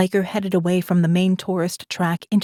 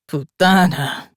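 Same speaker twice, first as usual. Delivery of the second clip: spat out; 3 dB louder, slower than usual. A narrator's reading. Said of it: "he spat".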